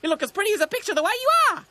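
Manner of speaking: high, loud, rapid bird voice; fast cartoon magpie; grinning magpie. A narrator's reading. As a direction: squawk a cheeky compliment. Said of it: "Sticky Beak grinned".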